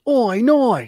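high comic Australian; Australian accent. Shown as a demonstration. Explaining the only accent they can do, high mock Australian. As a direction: demonstrate a put-on Australian accent. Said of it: "My only Australian, uh, accent I can do is".

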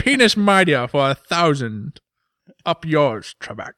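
loud, slow, hammed-up Scottish contestant; Sean Connery. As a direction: belligerent Scottish film-star impression. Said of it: "It's the Jeopardy parody".

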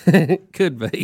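chuckling through the pain; laughing through pain. Real, not acted. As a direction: laugh it out but wince. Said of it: "That hurts to laugh".

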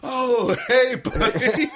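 high, loud innocent greeting; hammed-up high-pitched "nothing to see here"; caught-out dog. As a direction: cover up guilt with an over-friendly hello. Voicing dog caught in act.

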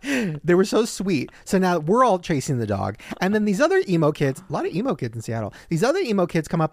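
normal talking voice

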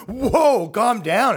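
high, loud "whoa, calm down"; mock startled shout. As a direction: ham up a scared bro backing off. People react to him entering.

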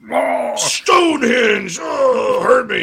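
high, loud, stretched mock Irish wail; hammed-up Irish accent. Radio host mocking a coach with an Irish accent, hammed-up wailing. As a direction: wail it out in an overdone Irish brogue. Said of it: "impersonate Dowell Loggins with an Irish accent".

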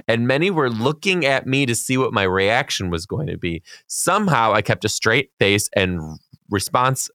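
measured read-aloud with swooping pitch; reading a letter aloud. A narrator's reading. Podcast host reading a teacher's classroom story, measured read-aloud. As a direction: read it out deliberately, slowing on key phrases.

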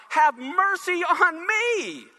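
high, very slow cry for mercy; David's plea. Acted. David calling out to God, high and drawn out. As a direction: draw out the plea to heaven.

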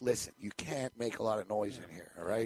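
low, soft warning to keep it down; quiet stern warning to keep it down; hushed warning. Retold or imitated. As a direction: shush her so nobody wakes.

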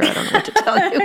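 mock shrug through a laugh. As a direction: laugh it off, voice rising high. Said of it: "And I'm like".

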